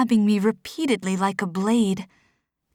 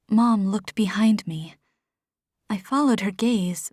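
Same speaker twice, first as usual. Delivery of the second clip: usual speaking voice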